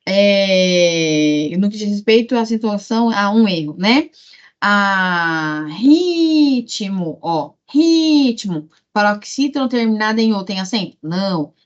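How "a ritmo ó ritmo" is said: RIIItmo, RI stretched out; calling the word. Shown as a demonstration. Teacher showing the tonic syllable, calling the word. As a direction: call out ritmo twice, holding RI.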